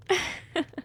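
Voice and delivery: little vampire giggle; amused giggle. Acted. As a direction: giggle lightly at your prey.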